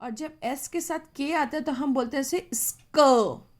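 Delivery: ordinary talk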